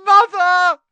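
very high, loud cry for help; desperate scream. A narrator's reading. Boy in chains calls to his mother, screaming. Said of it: "screamed the boy below".

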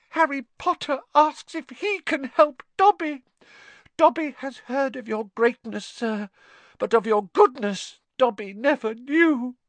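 very high, squeaky, loud elf voice; grateful house-elf. Acted. Dobby praising Harry's goodness, high squeaky gratitude. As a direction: squeak with gushing admiration.

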